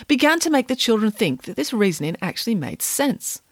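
usual speaking voice

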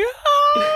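very high mock shriek; drawn-out high wail; imitated scream. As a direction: mimic his high scream. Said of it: "he's like".

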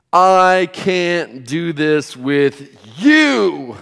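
drawn-out "you", swooping high and loud; slow, loud retold outburst landing hard on "you"; stress on YOU. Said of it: "the emphasis was".

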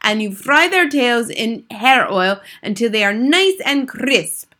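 lightly voiced commanding witch; theatrical witch giving orders; imperious head witch. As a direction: dictate a wicked recipe with emphatic relish. Grand High Witch dictates her recipe.

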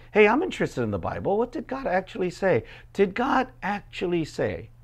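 lightly voiced sly inquirer; mock innocent serpent. Preacher voicing the serpent.

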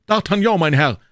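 loud, high introduction; announcing himself. Acted. Hero proudly gives his name.

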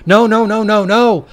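loud, high, frantic no-no-no; panicked exclaiming. A narrator's reading. Todd spilling from the van in a panic, loud panicked cries. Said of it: "Todd exclaimed".

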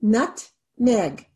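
nut-meg, each syllable tapped apart. Shown as a demonstration. Teacher tapping out syllables for students, slow and separated. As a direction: tap out "Nutmeg" slowly, syllable by syllable. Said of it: "How many syllables?".

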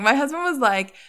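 usual speaking voice

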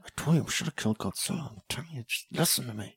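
mock angry muttering, very soft; hushed, trailing-away imitation of tense pleading; Kyle Chandler. Mocking the actor insisting they should have killed Godzilla, angry whisper. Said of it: "he really knows how to whisper angrily".

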